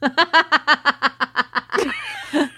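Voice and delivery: high burst of laughter; laughing. Real, not acted. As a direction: laugh out loud. Laughs after jingle.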